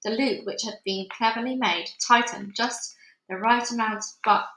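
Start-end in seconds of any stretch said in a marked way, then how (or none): none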